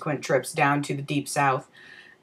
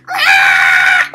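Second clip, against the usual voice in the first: piercing shrieked "Ah!", much higher and louder; high-pitched screech; 11 dB louder than usual. Retold or imitated. Ronnie showing off his pterodactyl call with a piercing screech. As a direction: let out an awful pterodactyl screech. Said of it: "screeches the loudest, most high-pitched".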